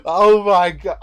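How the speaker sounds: real loud shock; loud exclamation. Real, not acted. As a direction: blurt it out in disbelief. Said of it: "reacting to leopard cuddle".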